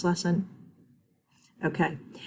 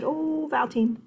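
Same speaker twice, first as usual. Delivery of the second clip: higher "Oh, vowel team" like a sudden discovery; mock realization; 9 semitones higher than usual. Shown as a demonstration. Teacher models a child noticing the vowel team, excitedly. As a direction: pretend to spot it suddenly. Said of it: "If you said".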